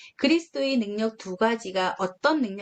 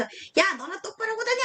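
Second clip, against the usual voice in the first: sneering jab, pitched much higher; a friend pointing and jeering at a would-be preacher; mocking friend; 9 semitones higher than usual. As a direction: taunt him like a scornful classmate. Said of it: "친구가 나를 손가락질하면서".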